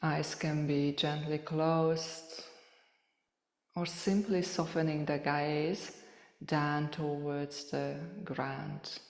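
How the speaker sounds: soft, unhurried meditation voice with long pauses; softly spoken, spacious yoga relaxation cueing; guided meditation. Real, not acted. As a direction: gently guide listeners through a body scan, leaving space between phrases.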